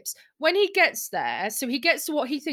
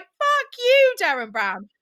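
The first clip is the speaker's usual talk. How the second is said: higher and louder mock yell of rage; furious outburst; 8 semitones higher, 5 dB louder than usual. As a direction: yell it at the host in imagined fury. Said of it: "I would be so mad".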